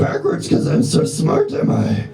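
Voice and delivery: mock Yoda. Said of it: "Just talk normal".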